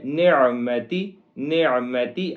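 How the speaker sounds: نعمتی repeated, ain from the throat; clear ain sound. Shown as a demonstration. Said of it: "عین کی آواز نکالیں گے". (نعمتی میں عین کی آواز صاف)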